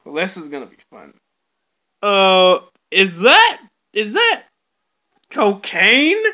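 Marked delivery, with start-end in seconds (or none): none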